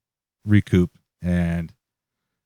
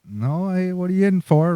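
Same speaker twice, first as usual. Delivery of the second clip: put-on high-pitched old lady chatting; little old lady; 9 semitones higher than usual. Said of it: "the little old ladies start".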